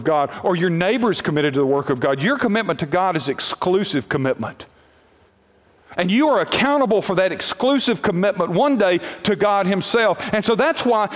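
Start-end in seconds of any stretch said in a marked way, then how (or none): none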